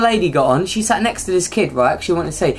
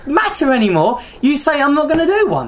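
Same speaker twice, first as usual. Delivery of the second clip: high-pitched comic indignation; exasperated rant; 8 semitones higher than usual. Real, not acted. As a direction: argue the point in a squeaky-high protest. Mock quarrel over refusing the accent.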